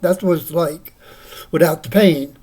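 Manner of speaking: usual speaking voice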